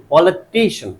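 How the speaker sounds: "Politician" with टि stressed; stress demo. Shown as a demonstration. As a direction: lean firmly on टि in "politician". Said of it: "क्या बोलेंगे?". ("Politician" में टि पर ज़ोर)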